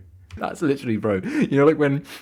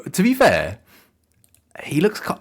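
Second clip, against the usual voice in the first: ordinary talk, nothing marked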